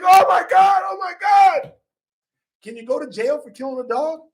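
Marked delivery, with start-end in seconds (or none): 0.0-1.7 very high, loud shocked cries; imitating a family screaming in horror; horrified shrieks. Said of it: "the family's like".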